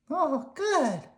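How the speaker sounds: high squeaky worm; high, cheerful little worm. Acted. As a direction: pitch it up like a tiny worm. Slimy voiced high.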